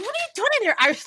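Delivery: high, mocking caricature of the teacher; lightly voiced mocking teacher. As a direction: parody her, high and snide. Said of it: "She's like".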